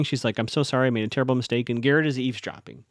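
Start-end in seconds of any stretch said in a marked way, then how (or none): none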